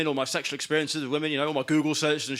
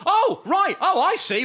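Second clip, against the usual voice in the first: high, loud put-on indignation; mock huffy; 12 semitones higher, 5 dB louder, slower than usual. Men answer women asking not to be harassed, mock huffy. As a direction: play an affronted man, pitch jumping up.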